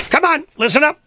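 high-pitched urging call to listeners; rally the audience to pay attention; rousing call. Real, not acted. Urging listeners to join contest.

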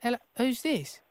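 high, quiet put-on child voice; little boy Billy. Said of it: "Billy? Bill-, Billy".

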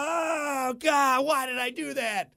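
high, hammed-up wailing for help; mock cry for help. On the shower floor, calling for help in a hammed-up wail. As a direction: whimper like he's hurt on the floor. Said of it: "floor going".

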